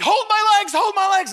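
high, loud pleading squeal, acted; panicked child. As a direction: imitate a boy yelling desperately for help. Scared son begs dad for help.

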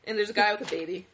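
ordinary delivery

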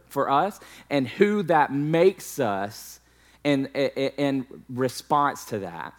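rising sermon delivery; preaching. Acted. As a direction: drive the point home to the congregation. Preaching the lesson.

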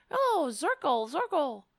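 high-pitched mock accent; a touch of put-on, teasing accent; Giuliani. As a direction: lightly imitate his mocking accent on the name. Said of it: "continued to say Zirkle's name in a mocking accent".

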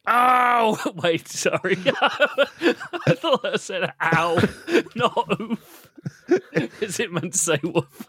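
giggling through the apology; cracking up mid-sentence; laughing. Real, not acted. Breaks up laughing.

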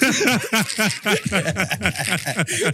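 laughing. Real, not acted. Teasing friend, laughing.

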